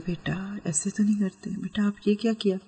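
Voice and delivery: put-on soft whisper to a child, leaning close. Shown as a demonstration. A mother whispering a gentle correction to her child. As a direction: hush it tenderly into the child's ear. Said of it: "اس طرح whisper کے انداز میں".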